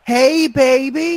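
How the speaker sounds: high, slow, drawn-out flirt; teasing imitation of a catcall; catcall from a car. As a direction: call it out long and high. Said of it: "I hear it again".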